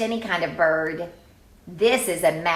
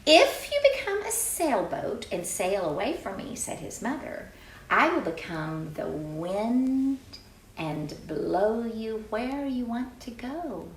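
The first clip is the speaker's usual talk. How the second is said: story narration; 3 semitones higher than usual. A narrator's reading. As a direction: read it like a promise at bedtime. Reading the mother's reply.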